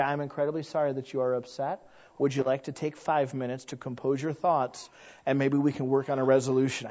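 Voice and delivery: soft, unruffled apology; calm polite reply. Retold or imitated. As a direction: stay calm while they scream at you. Calm person being screamed at.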